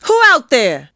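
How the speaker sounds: high, loud challenge; challenging shout. A narrator's reading. Old woman to kids hiding in the bushes, challenging shout. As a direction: call out at hidden intruders.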